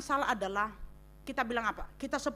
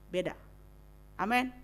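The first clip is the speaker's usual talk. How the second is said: usual speaking voice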